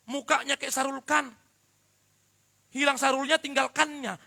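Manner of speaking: loud, high, emphatic sermon; forceful preaching. Acted. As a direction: hammer the point home to the congregation. (khotbah yang lantang dan berapi-api)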